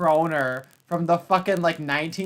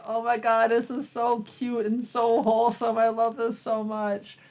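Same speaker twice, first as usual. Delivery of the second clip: higher, swooning coo; gushing delight; 7 semitones higher than usual. Retold or imitated. Reacting to a sweet old lady's interview, gushing and swooning. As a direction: melt over how cute it is. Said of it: "And I was like".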